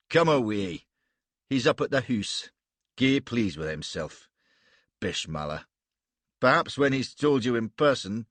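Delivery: a gruff warrior speaking broad Scots; Scottish accent. Acted. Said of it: "that astonishing accent".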